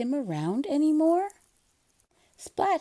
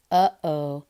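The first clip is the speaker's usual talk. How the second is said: lower, louder uh-oh; uh-oh moment; 6 semitones lower, 5 dB louder than usual. Acted. Splat realizing Seymour follows him, dismayed. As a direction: catch the problem suddenly.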